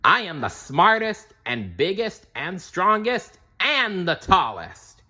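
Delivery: high, proclaiming villain boast; villain's boast. A narrator's reading. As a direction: declare it to the whole city. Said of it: "declared to all of Bumbleberg".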